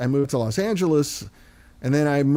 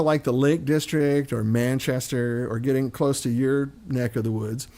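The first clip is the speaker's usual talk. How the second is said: ordinary talk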